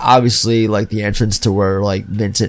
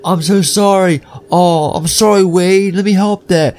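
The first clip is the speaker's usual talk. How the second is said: higher-pitched acted apology; mock apologetic; 7 semitones higher than usual. Character tries helping his injured friend, mock apologetic. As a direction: imitate a flustered apology, pitched up. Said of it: "And he's like".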